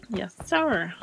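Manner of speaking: ordinary delivery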